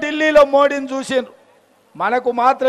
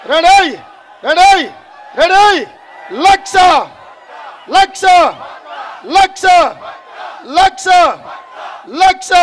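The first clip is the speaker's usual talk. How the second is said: rally shout; 2 semitones higher than usual. Real, not acted. Rallying the crowd before chant.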